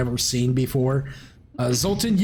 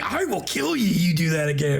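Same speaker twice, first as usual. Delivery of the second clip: threat; 3 semitones higher than usual. Retold or imitated.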